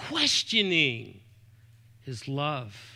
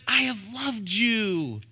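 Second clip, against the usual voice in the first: tender God's voice; 4 semitones higher, slower than usual. Acted. God's words voiced tenderly in sermon.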